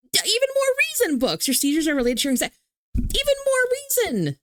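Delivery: high excited burst of encouragement. Real, not acted. Urging books toward therapy, high and excited. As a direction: leap up in pitch, cheering her on.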